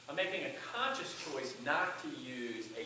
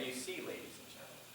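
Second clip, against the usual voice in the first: toned down; 9 dB quieter than usual. Shown as a demonstration. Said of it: "Or I say".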